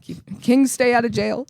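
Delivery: chuckling slogan; laughing. Real, not acted. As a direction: laugh through the line.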